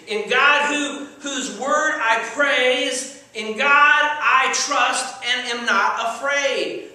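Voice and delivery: ringing, drawn-out Bible reading; scripture declamation. Acted. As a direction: proclaim the psalm boldly, stretching the words. Declaims a psalm of trust.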